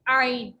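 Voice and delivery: आइन squeezed from the middle throat; pressed throat sound. Shown as a demonstration. Teacher repeats the letter for students, pressed throat आइन. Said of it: "इस तरीके से दबाव के साथ आवाज आती है".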